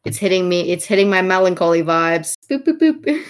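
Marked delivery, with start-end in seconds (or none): 2.5-3.2 high little boop noises; playful boops. Real, not acted. Reacting to melancholy vibes, playful boops.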